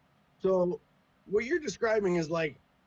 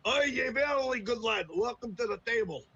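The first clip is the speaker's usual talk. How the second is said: higher, hammed-up tabletop player greeting; mock D&D player voice; 4 semitones higher than usual. Mocking a hardcore player welcoming a newcomer, hammed-up. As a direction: ham it up like an overeager role-player. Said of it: "you basically walked in and went".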